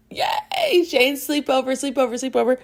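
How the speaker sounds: squealing high-pitched yay; sing-song giddy chant; excited cheer. Real, not acted. Cheering about the sleepover.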